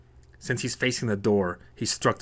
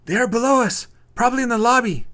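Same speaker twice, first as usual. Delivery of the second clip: high loud warning shout; yell; 14 semitones higher, 8 dB louder than usual. Acted. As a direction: yell the warning to Maria as you run. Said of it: "Brian yells at Maria".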